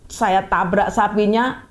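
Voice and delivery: usual speaking voice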